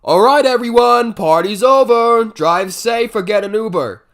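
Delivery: loud party-host shout; host announcement. Retold or imitated. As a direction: announce the end of the party to the whole room. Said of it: "came out and was like".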